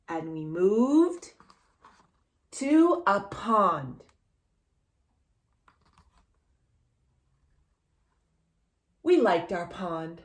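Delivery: slow, gentle storybook reading; reading to children. Acted. Reading the picture book aloud to a child, gentle.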